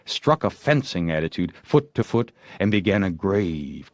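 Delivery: ordinary delivery, nothing marked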